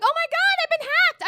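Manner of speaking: high, loud panicked squeal; mock panic, shrieking. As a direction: freak out like you've just been hacked. Panicked reaction to bank call.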